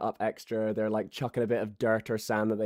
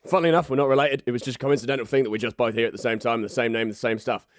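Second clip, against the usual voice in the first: ordinary talk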